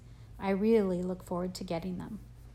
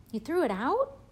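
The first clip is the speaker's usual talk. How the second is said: angry disbelief; 7 semitones higher, 5 dB louder than usual. A narrator's reading. Augie stunned and mad.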